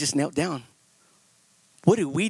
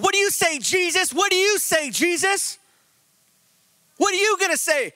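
mocking accusers; 9 semitones higher than usual. Religious men pressing Jesus.

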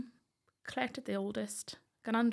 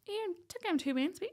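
blunt dairy-man dad; 10 semitones higher than usual. Retold or imitated. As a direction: tell them straight, she won't cope. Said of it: "typical Northern Ireland dairy man".

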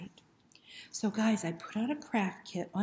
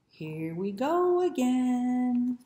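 slow, louder, fed up; weary dog; 5 semitones higher, 6 dB louder, much slower than usual. Acted. The dog sees the snake, slow and fed up. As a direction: sigh it out, not again.

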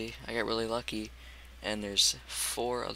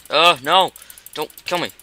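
loud, high panicked cry; panicked yelp; 6 semitones higher, 15 dB louder than usual. Real, not acted. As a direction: shout in sudden game panic. Zombie coming in the game.

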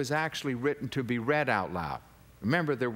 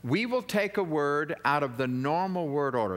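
ordinary delivery

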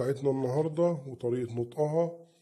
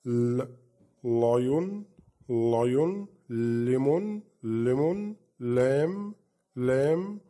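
L words said twice each, louder; teacher drill; 4 dB louder, much slower than usual. Shown as a demonstration. Teacher drilling L words for kids, louder. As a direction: model words for children to repeat. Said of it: "بننطقه زي اللام".

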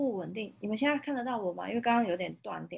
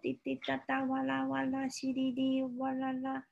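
high sing-song rain noises; rain sound effects; 6 semitones higher than usual. Acted. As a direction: play the rain for a toddler. Imitating rain sounds for child.

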